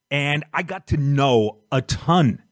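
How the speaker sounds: ordinary talk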